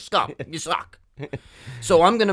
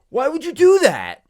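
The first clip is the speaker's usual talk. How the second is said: high, loud mock indignation; annoyed gambler; 10 semitones higher, 5 dB louder than usual. Strangers at the table scolding him, high indignant mock voice. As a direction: squeal it like an offended stranger. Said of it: "they go".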